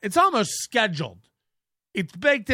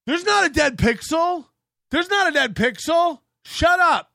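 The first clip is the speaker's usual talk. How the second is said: high, hammed-up indignant denial; mock meltdown yell; 9 semitones higher, 3 dB louder than usual. Streamer ranting at chat trolls, pretend outrage. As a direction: fake a sudden high-pitched rant. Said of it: "when I yell about the dead pixel".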